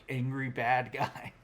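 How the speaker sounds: usual speaking voice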